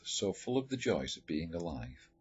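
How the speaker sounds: usual speaking voice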